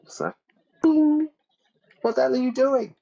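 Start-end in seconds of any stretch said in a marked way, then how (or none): 0.8-1.3 very high, loud little ping; imitated plucking noise. 2.0-2.9 very high squeaky mock protest; startled suspect. Voices suspect protesting hair pluck.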